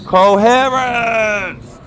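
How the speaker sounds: high, loud, long-stretched announcer cry; drawn-out call. Pretend. Vlogger capping the coherent levels line, loud drawn-out cry. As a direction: belt it out and hold the word.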